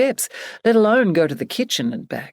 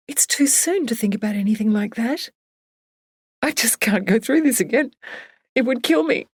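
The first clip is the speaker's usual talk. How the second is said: lightly voiced, a touch higher; grieving friend; 6 semitones higher than usual. Barbara telling Emily she can't face another loss.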